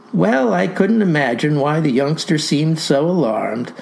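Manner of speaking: ordinary talk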